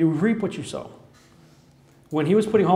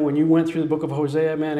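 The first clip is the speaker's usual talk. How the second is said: usual speaking voice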